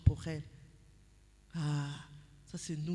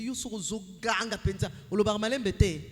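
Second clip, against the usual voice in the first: mock family scolding; 7 dB louder than usual. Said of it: "on te disait".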